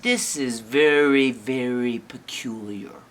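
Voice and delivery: lightly voiced, deliberate puzzlement; pondering Papa Bear. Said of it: "Papa's like".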